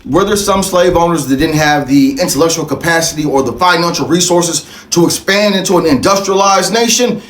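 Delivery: ordinary delivery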